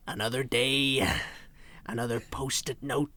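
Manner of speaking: low, vague film-star impression; Jack Nicholson. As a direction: drop low and trail away in a half-baked impression. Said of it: "I can vaguely do a Jack Nicholson impression".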